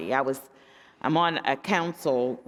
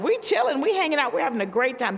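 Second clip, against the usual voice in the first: carefree friends; 10 semitones higher, faster than usual. Retold or imitated. Pastor mocks suburban friends saying all is fine, high and light. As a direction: lightly parody friends who have it easy, pitched up. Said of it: "they're like".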